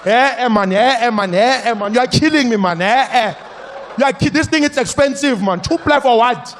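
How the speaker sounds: high, loud complaining rant; ranting uncle. Retold or imitated. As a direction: lecture her about the cost.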